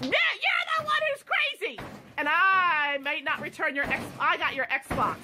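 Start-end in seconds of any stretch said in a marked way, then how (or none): 0.0-1.8 high, strained retort flung back at him; indignant, pitch jumping up high; real angry retort. Real, not acted. Mother to son who wrecked the TV, high angry retort.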